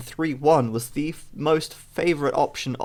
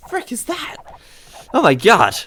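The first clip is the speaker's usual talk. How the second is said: surprised; 9 semitones higher, 6 dB louder than usual. Real, not acted. Sudden odd tree appears.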